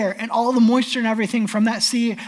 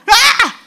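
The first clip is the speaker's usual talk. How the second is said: hammed-up unmanly shriek; loud, high comic scream; cry of fear; 12 semitones higher, 9 dB louder than usual. Shown as a demonstration. Preacher shows how grown men cry out, mock fearful shriek. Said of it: "crying out in fear sound like".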